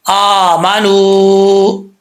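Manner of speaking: आमानू very slow, long madd on the first vowel; Quranic recitation. Shown as a demonstration. Tajweed teacher recites आमानू for the class, slow and drawn out. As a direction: chant आमानू with the full stretched alif. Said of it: "आमानू नहीं पढ़ना".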